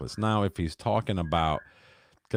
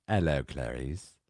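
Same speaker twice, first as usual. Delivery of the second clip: Hannibal Lecter voice; 3 semitones lower, slower than usual. Retold or imitated. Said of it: "Hannibal Lecter saying".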